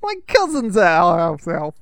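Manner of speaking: put-on a New York accent that slides into Russian, pitched high; New York accent drifting Russian. Host doing a bad New York impression, slipping into Russian. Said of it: "trying to do the New York".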